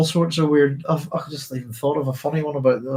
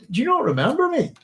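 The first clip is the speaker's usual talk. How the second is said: much higher-pitched retort, retold; indignant protest; 13 semitones higher than usual. Asking old friends if they remember him, high-pitched mock indignation. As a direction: imitate yourself protesting in a high, incredulous voice.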